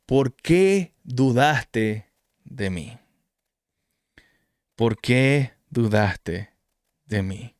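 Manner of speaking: slow, low, tender questioning; gentle rebuke. Retold or imitated. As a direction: ask it slowly like a patient teacher. Jesus gently rebukes sinking Peter. (reproche lento y tierno)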